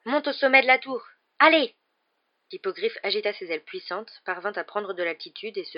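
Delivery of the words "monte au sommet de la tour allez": high, loud driving call; urgent command. Acted. Harry pushing Buck up the tower, building to a yell. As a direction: drive him up with a shout. Urging the hippogriff upward.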